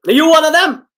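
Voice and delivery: high, loud, sudden demand; shouted question. Acted. The man shouts at frightened Barbara, loud and high. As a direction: bark it at her as a threat.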